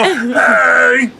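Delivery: sudden high shouted "Hey!"; mock shout. Comic lifting a small friend, shouting. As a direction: bellow it like you've just scooped them up. Lifting someone with one arm.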